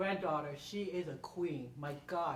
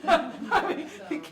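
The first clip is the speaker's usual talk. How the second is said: high loud laugh into words; 12 semitones higher, 9 dB louder than usual. Real, not acted. Laughing after joking about useful wives, high and loud. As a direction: laugh off your own joke.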